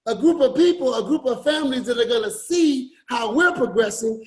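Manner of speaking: ordinary talk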